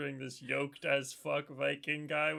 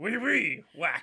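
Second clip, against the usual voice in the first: put-on goofy French accent; 2 semitones higher, 6 dB louder, slower than usual. Friend mocks a French-speaking Viking, loud goofy French accent. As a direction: ham up a French accent for laughs.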